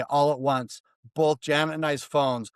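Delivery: normal talking voice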